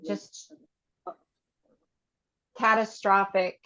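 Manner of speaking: slow statements with long pauses between, for the group to repeat; guided tapping statements. Acted. Facilitator leading the group through tapping, slow and measured. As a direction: lead each feeling out calmly, one by one.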